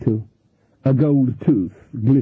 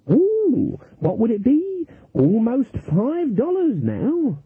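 put-on very high, stammering old lady; Granny fakes wonder at winning; mock-delighted granny; 11 semitones higher, much slower than usual. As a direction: gush like a naive beginner. Granny innocent.

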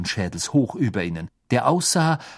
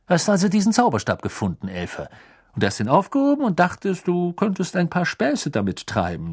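hohe, laute Beamtenstimme; strenger Beamter; 8 semitones higher than usual. A narrator's reading. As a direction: streng und von oben herab. Diggory accuses the elf. (strenger Ministeriumsbeamter)

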